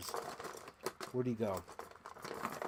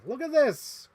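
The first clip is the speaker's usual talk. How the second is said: much higher, loud exclaim; excited; 12 semitones higher, 8 dB louder, slower than usual. Real, not acted. Collector showing viewers a toy, much higher and loud. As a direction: thrust the toy forward, thrilled.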